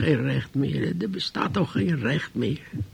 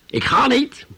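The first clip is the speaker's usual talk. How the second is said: defiant shout; 6 semitones higher, 6 dB louder than usual. Retold or imitated. Old man defies the neighbour.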